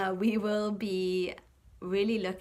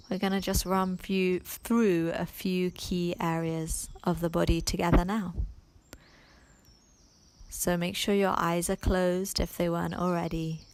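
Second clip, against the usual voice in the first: unhurried meditation voice with long pauses; guided meditation. Acted. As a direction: ease the listener into letting go, phrase by phrase.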